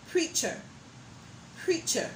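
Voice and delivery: preacher ending in a weak uh; schwa ending demo. Shown as a demonstration. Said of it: "words that says the sound".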